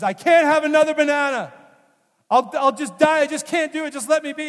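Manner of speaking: overfed patient begging the nurse, pleading loudly; desperate patient. Retold or imitated. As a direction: beg them to stop the bananas. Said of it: "the guy interrupts and says".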